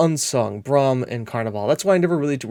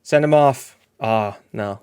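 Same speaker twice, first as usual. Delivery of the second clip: ordinary delivery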